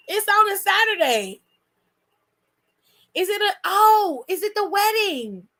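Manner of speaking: usual speaking voice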